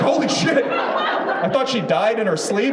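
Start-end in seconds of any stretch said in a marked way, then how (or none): 0.0-0.6 high startled exclamation; shocked gasp. Retold or imitated. Comic waking next to a still mom, shocked. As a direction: blurt it out in shock.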